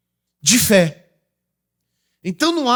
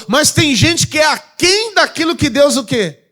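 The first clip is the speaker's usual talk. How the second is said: louder, higher call-out; preaching shout; 7 semitones higher, 4 dB louder than usual. Real, not acted. Preacher calls out to the congregation in a loud shout. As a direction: shout the question to the church.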